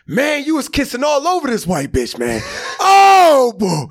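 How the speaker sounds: high, loud mock shout; blurting friend. Friend on speakerphone blurting about the kissing, a high mock shout.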